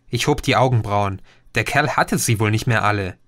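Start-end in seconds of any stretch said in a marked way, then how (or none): none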